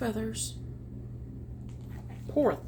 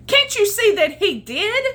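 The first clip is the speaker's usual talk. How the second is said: high, loud indignant squawk; high, loud cranky goose; 10 semitones higher, 9 dB louder than usual. Acted. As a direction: squawk it out crossly. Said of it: "squawked the goose".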